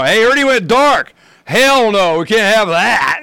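high and loud, slowing on the end; mock outrage at going dark; playful indignant outburst; excited retort. Retold exclaiming to installer.

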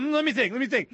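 hammed-up mischievous musing; mock scheming ponder. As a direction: plot it out with relish. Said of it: "I'm just going".

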